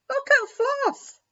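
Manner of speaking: put-on high, loud excited child's call; children yelling. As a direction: shout it out across the field to Dad. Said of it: "yelled the children".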